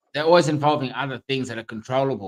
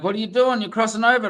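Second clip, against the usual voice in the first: retold yell; 9 semitones higher than usual. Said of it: "you could be yelling at 'em".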